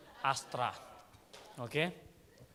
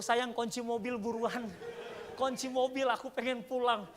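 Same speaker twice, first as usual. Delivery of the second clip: panicked plea; 9 semitones higher, 4 dB louder than usual. Retold or imitated. Wants to flee in shame.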